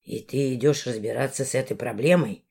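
lightly voiced low male, grumbling; Valdemar voice.

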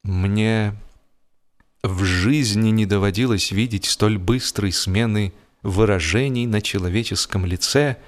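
ordinary delivery, nothing marked

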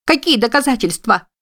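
high, frantic panicked shriek; panicked squeal. A narrator's reading. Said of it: "Сорвалась на панический визг Адри".

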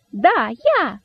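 high, bold little cry; cheeky exclaiming rabbit. A narrator's reading. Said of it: "воскликнул дерзкий кролик".

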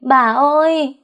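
a loud, high cry for help; wailing cry. Acted. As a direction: yell for the grandmother at full voice. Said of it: "cái Hằng gào lên". (gào to, giọng cao vút)

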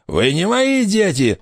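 high-pitched panicky denial; frightened cry. A narrator's reading. Frightened pirate denies the ghost children, high cry. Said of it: "вскричал, прицеливаясь, перепуганный Хайнд".

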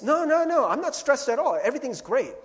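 high, loud insistent denial; mock denial. Denying stress to a friend, high and loud protest.

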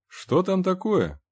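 high, slow called-out question; loud question. A narrator's reading. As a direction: call out to find out what's there. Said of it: "громко спросил Генри".

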